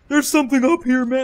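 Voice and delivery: high, loud mock excitement; imitating excited teammate. Teammate pointing out something up there, imitated excitement. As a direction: shout the discovery, playfully imitating him. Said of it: "And he's like".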